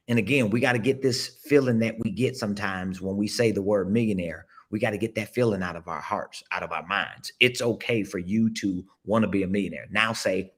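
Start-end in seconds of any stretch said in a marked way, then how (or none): none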